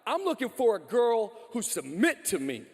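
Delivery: brash bro voice, quoting guys he meets; mock macho guy. Pastor mocking guys at Bible study, brash bro voice. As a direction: swagger it like a cocky dude. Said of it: "I meet a lot of guys who read this passage and go".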